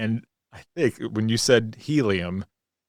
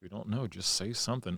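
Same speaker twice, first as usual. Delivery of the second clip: quiet; 9 dB quieter, faster than usual. Retold or imitated. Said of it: "the Dalai Lama was like".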